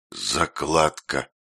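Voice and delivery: slow, low hoarse rasp. A narrator's reading. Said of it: "сипло произнёс Тиль".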